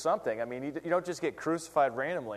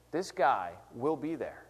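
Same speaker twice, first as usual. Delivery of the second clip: ordinary talk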